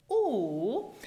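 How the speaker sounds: pure single O vowel, no glide; steady O without gliding. Shown as a demonstration. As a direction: hold O as one pure vowel. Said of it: "listen to the difference between the single vowel".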